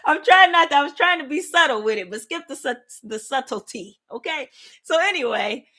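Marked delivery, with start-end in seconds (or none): 0.0-1.2 laughing. Real, not acted. Laughing at own bangs.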